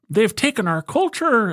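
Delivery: high mock-angry put-on voice; angry edgy Peterson. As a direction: ham up a grievance. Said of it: "angry, edgy kind of".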